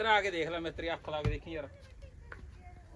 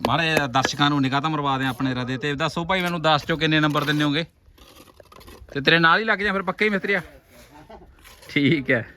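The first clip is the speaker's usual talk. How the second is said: louder, hyping the viewers; loud vlogger address; 10 dB louder than usual. Real, not acted. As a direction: shout it to the camera audience. (ਦਰਸ਼ਕਾਂ ਨੂੰ ਉੱਚੀ ਆਵਾਜ਼ ਵਿੱਚ)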